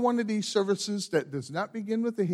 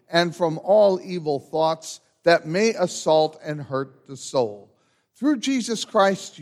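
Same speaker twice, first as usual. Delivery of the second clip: loud, slow prayer spoken with the congregation; unison prayer; 7 dB louder than usual. Acted. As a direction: pray aloud together, steady and strong.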